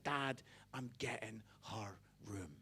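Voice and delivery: very low, hushed deadpan son. Retold or imitated. Son to dad about sister's room, low deadpan. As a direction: drop right down to a quiet, unmoved murmur.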